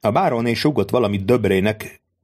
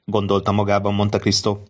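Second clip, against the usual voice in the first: usual speaking voice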